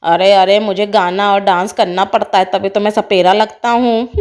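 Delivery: low, put-on man's voice; boastful snake charmer. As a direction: drop deep and justify the act. Snake charmer explains himself.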